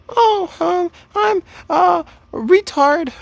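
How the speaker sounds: put-on squeaky falsetto girl fumbling her name; girl's voice. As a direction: stammer it out as the girl. Girl's reply in acted pickup.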